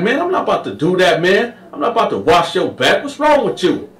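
high, fast refusal; high rapid no; Carl refusing. Retold or imitated. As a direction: push it away quickly. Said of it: "Carl was like".

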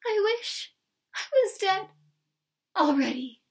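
high, loud stage despair. Acted. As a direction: wail it like a tragic countess. Countess's melodramatic despair onstage.

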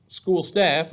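ordinary delivery, nothing marked

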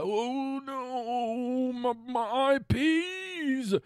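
high, slow, whiny wail of "my I Ps"; mock dismay. Host mocking a fussy owner of intellectual property, high wail. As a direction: clutch your I Ps like a fussy victim.

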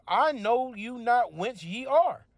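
slow, high, deliberate refusal; slow stern reply. Acted. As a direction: turn them away word by word. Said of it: "he shall answer and say".

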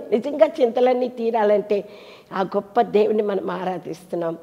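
ordinary delivery

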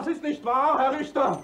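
high, strained protest; indignant young man. Acted. Young man protesting to the judge, high and indignant. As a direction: cry out your innocence to the judge.

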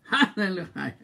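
high, loud, laughing praise; laughing hallelujah. Real, not acted. Preacher laughing to the congregation.

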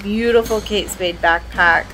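normal talking voice

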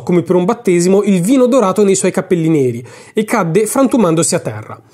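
plain reading voice

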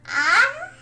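high, loud squeal of pleasure; delighted squeal. Pretend. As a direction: squeal like a pampered pet. Getting head pats.

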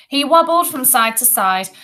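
usual speaking voice

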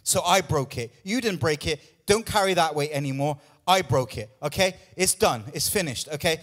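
ordinary talk, nothing marked

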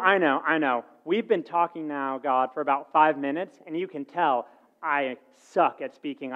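normal talking voice

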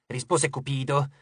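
ordinary delivery, nothing marked

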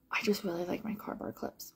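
breathy hushed whisper; soft secret whisper. Pretend. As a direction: whisper it like a little confession. Repeats the line in two voices.

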